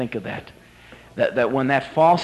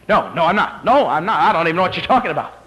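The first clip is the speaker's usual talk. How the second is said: rapid, slightly raised flustered denial; 3 semitones higher, 4 dB louder, faster than usual. Acted. Peter denying the girl's accusation, flustered. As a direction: deny it in a hurry.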